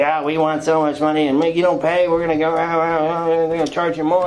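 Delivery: nagging creditor, pitched a bit high; hammed-up demanding debt letter; mock bill collector. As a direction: rattle off threats, trailing into ah-ah noises. Acts out a threatening collection letter.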